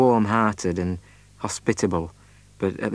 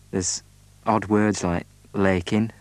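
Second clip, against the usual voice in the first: normal talking voice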